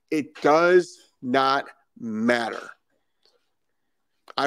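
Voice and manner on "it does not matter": every word of "It does not matter" slowed right down and pressed; emphatic slow stress. Real, not acted. Stressing to viewers that the words don't matter, slow emphatic. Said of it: "I can't stress this enough".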